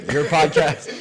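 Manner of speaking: laughing while teasing; giggling through the words. Real, not acted.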